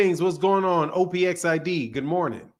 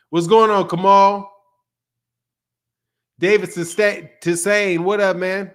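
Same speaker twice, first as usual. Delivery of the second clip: loud greeting; 3 semitones higher, 7 dB louder than usual. Real, not acted. Shouting a greeting to viewer.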